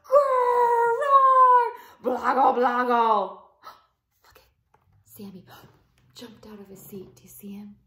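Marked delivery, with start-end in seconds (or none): 0.1-3.4 loud, high hammed-up monster roar; big playful monster growl. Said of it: "the monster gave it all he had". 3.6-7.2 quick hushed whisper with gasps; startled whisper; gasping whisper. Real, not acted.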